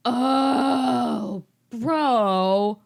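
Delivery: hammed-up sob over a friend; fake-cry the word bro, fading to breath; mock crying. Harry mock-sobs at betrayal by Quirrell.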